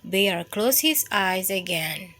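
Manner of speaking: plain reading voice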